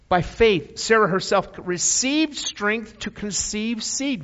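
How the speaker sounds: loud, high, rising preacher's cry; preaching at full force. Acted. Preacher to his church, loud rising proclamation. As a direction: lift the church with Sarah's faith.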